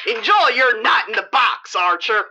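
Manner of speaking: fast, hard-edged guard voice; tough prison guard. Acted. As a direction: bark it like a mean warden.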